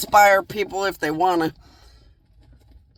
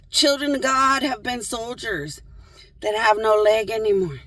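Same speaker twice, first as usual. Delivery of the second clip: usual speaking voice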